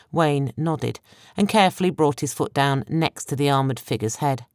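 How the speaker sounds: plain reading voice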